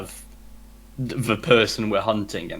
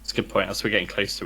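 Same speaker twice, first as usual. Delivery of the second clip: usual speaking voice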